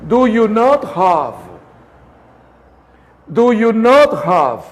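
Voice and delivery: "not" stressed, falling on "not"; voice inflection. Shown as a demonstration. Teacher showing students the inflection on "not". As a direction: lean on "not" with a falling tune. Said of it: "Aquí hay una pequeña inflexión de voz".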